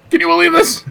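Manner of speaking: very high, indignant squawk; mock yelling. Bus ranter to an unwilling stranger, mock outraged yelling. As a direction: pester a stranger with shrieked disbelief. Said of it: "Why are we yelling?".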